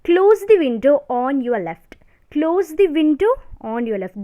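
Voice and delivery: slow, word-by-word dictation for notes. Shown as a demonstration. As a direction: read the rule out slowly so students can write. Dictating example slowly.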